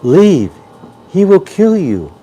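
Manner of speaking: rising frantic warning, leave; normal voice. Acted. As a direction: plead with him to get out. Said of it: "in a normal voice".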